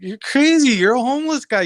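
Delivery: high, loud mock outburst at the man; incredulous yelled comeback; retold shout. Retold airport spat, quoting himself.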